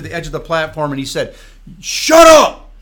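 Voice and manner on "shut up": barked, much higher and louder; preacher silencing a heckler with a drill-sergeant shout; drill instructor shout. Retold or imitated. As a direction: yell it like a Marine drill instructor. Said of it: "turned into a Marine D I".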